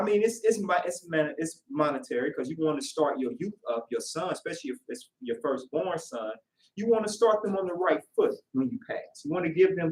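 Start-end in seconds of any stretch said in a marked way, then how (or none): none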